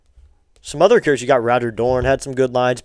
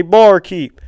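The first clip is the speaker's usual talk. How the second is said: mock accent, a bit louder and higher, slower; imitating Eddie Harris; 6 semitones higher, 6 dB louder, slower than usual. Fan quoting Harris's movie line to chat, in mock accent. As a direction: ham up the barroom line in his accent. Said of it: "you gotta say with the accent".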